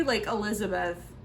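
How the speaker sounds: ordinary delivery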